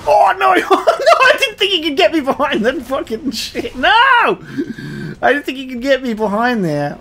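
high, loud shrieking laughter and dismay; panicked screaming. Real, not acted. Player caught from behind by boss, panicked screaming. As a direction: scream in shock, half laughing.

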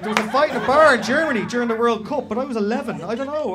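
hammed-up baffled rambling; Will, confused. Confused stag guessing at his crime, hammed-up fluster. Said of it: "that level of confusion".

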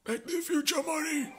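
high-pitched mock movie shout; excitable film-character impression. Calling to Marty about the future, high and hammy. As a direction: call out the catchphrase far above your own pitch.

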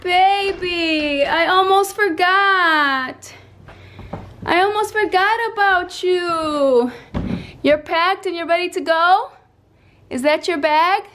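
high, sing-song pet voice. Real, not acted. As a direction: coo at her pet. Baby talk to her pet.

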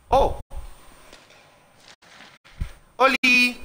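excited. Real, not acted. Greeting a creature on screen.

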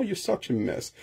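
soft teasing You're such a mess; teasing joke. Shown as a demonstration. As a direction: rib a funny friend. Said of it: "pa pran literal". (ap takinen zanmi)

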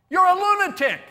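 high, loud scornful jeer; scoffing shout. Acted. Crowd jeering at Jesus, loud scornful shout. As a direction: shout the insult with mocking disbelief.

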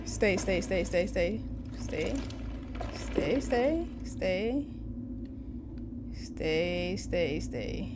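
soft, cautious repeated commands; hushed coaxing. Real, not acted. Player telling game figures to stay, hushed coaxing. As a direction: coax them softly, as if not to startle.

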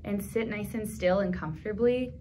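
put-on gentle children's relaxation guide; guided breathing. Leads a breathing exercise.

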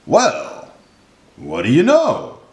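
high "well", then back down; mildly astonished elephant; crying out, amazed. A narrator's reading. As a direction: exclaim in pleased surprise. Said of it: "cried the elephant".

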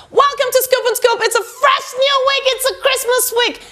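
high, sustained presenter's welcome; show intro. Acted. Delivers the show's intro.

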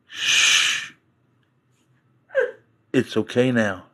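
hushed, soft comforting voice; a fizzing can, whispered and tender; soothing soda whisper. Retold or imitated. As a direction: shush it gently like calming a baby. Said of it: "It's going".

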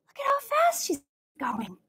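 excited breathy whisper. Acted. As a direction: lean in and share a secret. Whispered aside to kids.

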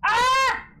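high, loud startled scream. Real, not acted. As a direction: shriek in fright. Sudden scare in ghost game.

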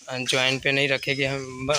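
ordinary talk, nothing marked

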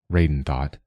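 ordinary delivery, nothing marked

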